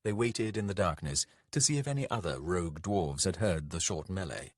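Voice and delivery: usual speaking voice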